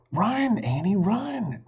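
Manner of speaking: high urgent cry; a child shouting to run. A narrator's reading. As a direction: call out to her in alarm. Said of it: "cried Jack".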